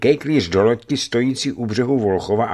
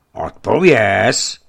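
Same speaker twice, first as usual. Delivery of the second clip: louder, very slow single-word command; angry demand; 7 dB louder, much slower than usual. A narrator's reading. Governor angrily demands an answer, loud and slow. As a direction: force the answer out of him. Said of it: "zasypal posadník".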